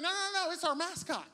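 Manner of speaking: high-pitched, insistent protest; flustered explaining. Acted. Fan explaining the mascot to baffled outsiders, high-pitched flustered protest.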